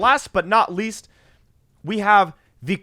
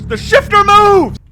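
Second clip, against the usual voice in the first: high, loud, drawn-out yell of discovery; thrilled shout; 12 semitones higher, 9 dB louder, slower than usual. Real, not acted. Gamer spotting the moving shifter, thrilled shout. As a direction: shout it out in sheer amazement.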